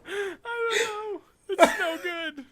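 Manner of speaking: high, cracking words through helpless laughter; laughing hard. Real, not acted. Host breaks up laughing at the bad accents. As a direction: laugh until the voice squeaks.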